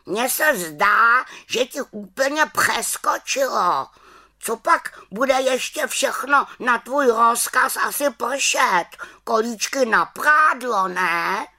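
high, indignant raven squawk; scolding raven. Acted. Raven mocking the witch's commands, high scolding voice. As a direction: nag like a cross bird, pitched high.

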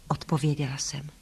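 ordinary delivery, nothing marked

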